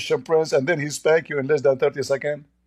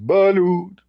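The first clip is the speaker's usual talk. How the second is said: mock vendor call; 5 semitones higher than usual. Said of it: "each time he screamed".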